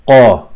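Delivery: qa hit loud from the top of the throat; hard full K. Shown as a demonstration. Teacher introducing qaf, loud hard qa from the throat. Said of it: "a very hard and full K".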